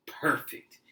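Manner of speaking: a loud, hissed touch of villain glee; mock-sinister approval; breathy gleeful crook. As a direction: hiss it like a pleased burglar. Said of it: "Harry said".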